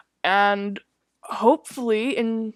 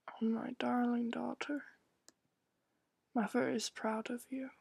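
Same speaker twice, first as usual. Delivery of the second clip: whispered; 15 dB quieter than usual. Acted. Mother's voice whispers approval.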